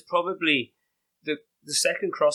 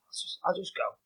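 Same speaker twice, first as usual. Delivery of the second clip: whispered thought; 5 semitones higher, 6 dB quieter than usual. Retold or imitated.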